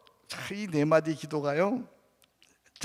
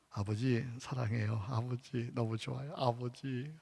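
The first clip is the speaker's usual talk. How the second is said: soft, lowered intimate calling; tender prayer; 4 semitones lower, 10 dB quieter than usual. Shown as a demonstration. Preacher calls on Father in a tender hush. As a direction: call Father gently, close and tender.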